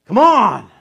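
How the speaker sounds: loud, high, exasperated push; loud "Come on" falling hard; impatient tone. Shown as a demonstration. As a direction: bark it at a slow spouse. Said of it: "It's just tone".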